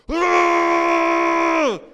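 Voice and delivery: very high, loud mock scream of pain; labor cry. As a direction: yell out through a contraction. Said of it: "she's over there going".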